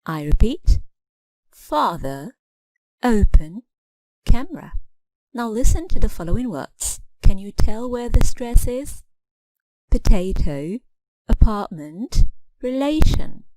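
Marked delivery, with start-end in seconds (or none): 1.5-4.7 "father, open, camera" each stressed on the opening syllable; first-syllable stress. Shown as a demonstration. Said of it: "hear the stress on the first syllable". 9.9-13.4 "Potato, apartment, relation" each stressed on the middle syllable. Shown as a demonstration. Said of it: "the middle syllable is stressed".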